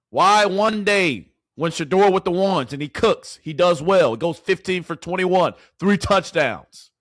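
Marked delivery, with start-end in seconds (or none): none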